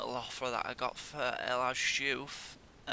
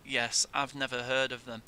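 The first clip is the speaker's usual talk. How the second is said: ordinary talk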